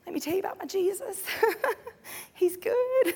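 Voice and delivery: high, soft, quick and giggly reply; delighted believer. Acted. Julie tells coworker about her Jesus, high soft delight.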